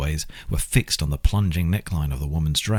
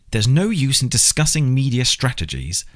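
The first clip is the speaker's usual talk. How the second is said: louder, higher irritated snap; snapping; 8 semitones higher, 4 dB louder than usual. Acted. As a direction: bite the words off in frustration. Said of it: "he snapped".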